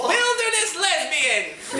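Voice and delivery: loud high laughing cry; laughing shout. Acted. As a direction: burst out laughing as you shout. Laughing exclamation.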